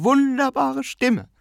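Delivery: drawn-out rapturous repetition; emphatic repeat. A narrator's reading. Aunt repeats her praise.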